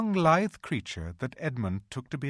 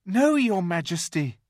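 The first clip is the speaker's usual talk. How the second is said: put-on nervous boy; 9 semitones higher, 8 dB louder than usual. A narrator's reading. Edmund answers witch.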